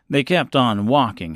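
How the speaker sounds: ordinary delivery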